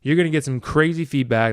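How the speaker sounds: usual speaking voice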